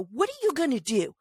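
high, pushed-up challenge to a driver; mock-daring the man who got out of his car; confrontational yell. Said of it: "and I go".

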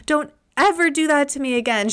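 high, fast mock scolding from mom; a mother telling off her kid in relief; quick mock reprimand. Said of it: "she was like".

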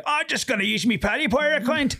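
cartoonish Northern Irish accent, pitched well up; exaggerated Northern Irish. A coworker placing his bets, mimicked in a cartoon Northern Irish accent. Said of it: "over-exaggerated".

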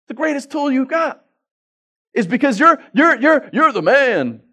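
high, loud hammed-up boast; mock bravado. Preacher mocking dads who think they're the man, mock bravado.

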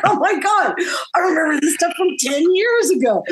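high, incredulous mock reaction; laughing disbelief in a high voice; retold amazement.

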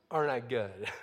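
low, understated boast; smug Pharisee. Acted. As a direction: drop it low, as if settled. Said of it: "he pulls out the tax collector's resume".

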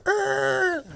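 high screeching car noise; imitated screech. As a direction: squeal like a car stopping short. Imitates the cop car stopping.

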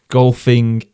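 ordinary talk, nothing marked

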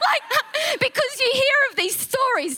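high, laughing through the words; real laughter while talking. Real, not acted. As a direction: giggle as you explain.

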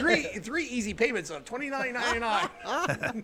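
hammed-up TV sales pitch, laughing; home shopping host. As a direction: sell it like a late-night infomercial. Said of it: "mock infomercial pitch".

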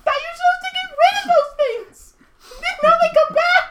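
very high, loud goblin complaining through pain; whining goblin. Acted. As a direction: goblin griping about his kidney stone. Hurt goblin keeps complaining.

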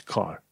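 "car" with an open "ah" vowel. Shown as a demonstration. Teacher showing learners "car" with open A. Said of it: "să fie pronunțat ca A".

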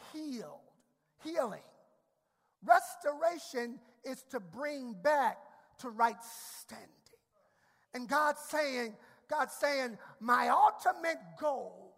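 loud, rising sermon cadence; fervent pulpit preaching; preaching at full force. Acted. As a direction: preach it hard to the congregation.

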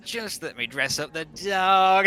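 mock Daphne. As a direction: lightly send up her sappy delivery. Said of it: "It's just tainted with the".

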